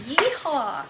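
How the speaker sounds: high, loud cowboy whoop; excited yeehaw. Real, not acted. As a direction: cheer at the foam. Vinegar makes it foam.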